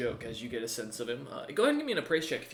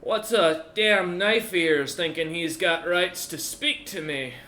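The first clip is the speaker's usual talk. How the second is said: put-on raised, loud and slow, coughing old warlord; old dwarf chieftain; 5 semitones higher, 7 dB louder, slower than usual. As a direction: taunt them like a battered old warrior. GM voices Odger.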